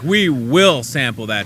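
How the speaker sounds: slow, weighty "We will sample that" landing down; firm statement. Shown as a demonstration. Host showing the confident version, slow and firm. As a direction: deliver it like a promise. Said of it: "It wasn't like".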